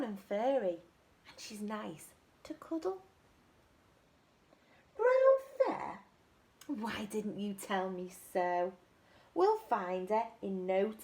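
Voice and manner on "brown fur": high, loud amazed question; butterfly struck by the clue; surprised question. Acted. Butterfly surprised the mum lives in the trees, high and loud.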